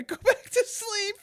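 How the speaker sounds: high, helpless giggly delivery; laughing through words. Real, not acted. Breaks up laughing mid-sentence.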